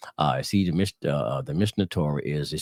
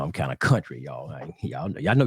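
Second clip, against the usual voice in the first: ordinary delivery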